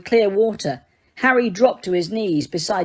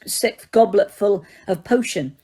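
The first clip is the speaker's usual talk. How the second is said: ordinary delivery, nothing marked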